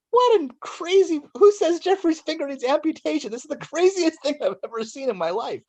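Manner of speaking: high, laughing disbelief as the nurse. Retold or imitated. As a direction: squeal it out, laughing at the absurd note. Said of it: "then now she laughs at the note".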